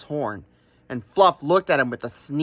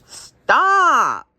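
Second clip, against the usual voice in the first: a much higher, louder ram's cry; commanding stop; 11 semitones higher, 5 dB louder than usual. Acted. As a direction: halt him sharply. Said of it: "said the Ram".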